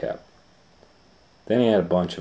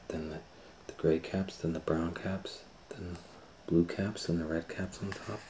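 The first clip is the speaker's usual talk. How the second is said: hushed; 8 dB quieter than usual. A narrator's reading. Peddler carefully checking caps.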